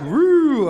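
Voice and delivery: high, loud siren whoop; imitated police siren. Trooper pulling over a speeding driver, imitated siren whoop. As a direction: imitate a squad car's whoop.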